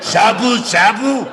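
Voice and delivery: slow, mock bad Japanese accent; drawn-out mock Japanese accent.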